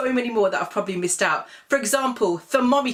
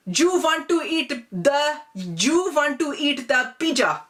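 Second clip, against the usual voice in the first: put-on auntie's Desi accent; 5 semitones higher than usual. Auntie offering pizza to her niece, high Desi accent. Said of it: "it will be".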